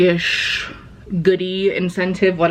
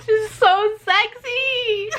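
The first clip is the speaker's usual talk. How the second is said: swooning; slower than usual. Real, not acted. Swooning over a character.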